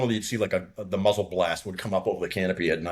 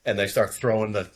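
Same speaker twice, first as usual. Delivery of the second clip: ordinary talk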